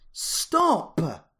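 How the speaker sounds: sharp high shout from behind; yell. A narrator's reading. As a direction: call out to stop her, loud and high. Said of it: "yelled a voice".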